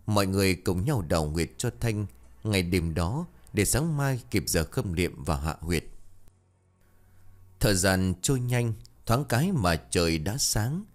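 usual speaking voice

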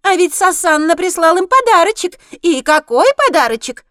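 high, loud squeaky harpy; taunting shrill creature voice. A narrator's reading.

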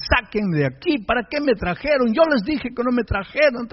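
high-pitched mock yell without volume; complaining outburst, held back in level; retold yelling. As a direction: imitate him protesting to his parents. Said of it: "y a gritar a los padres".

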